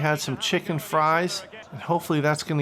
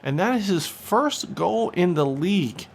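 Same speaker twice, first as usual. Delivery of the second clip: play-by-play commentary. Acted. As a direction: call the action like a TV commentator. Commentates his own FIFA match.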